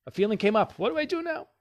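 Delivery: high, loud, hammed-up alarm; mock panic. Someone overwhelmed by a new feeling, mock panic rising high. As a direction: play the flustered beginner who has no idea.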